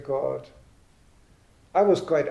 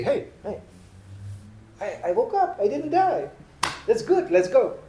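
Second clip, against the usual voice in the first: higher-pitched, pleasantly amazed self-talk; 8 semitones higher than usual. Acted. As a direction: act out being amazed to find yourself alive. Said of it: "I'm amazed every day".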